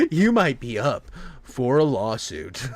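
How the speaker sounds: low, soft, slow TV ad read; deep, measured parody announcer; lawsuit ad announcer. Acted. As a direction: intone it like a legal commercial. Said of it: "one ad that comes on".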